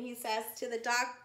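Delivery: lively storybook read-aloud for little ones; reading to children. Acted. Teacher reading a zoo story to children, lively storytime voice.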